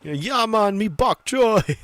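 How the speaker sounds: high, loud hammed-up Jamaican accent; mock Jamaican accent. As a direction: belt out a bad Jamaican impression. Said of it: "Damn, I'm good at impressions".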